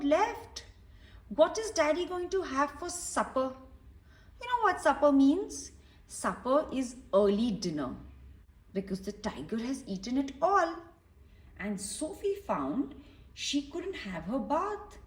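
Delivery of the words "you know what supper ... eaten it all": ordinary delivery, nothing marked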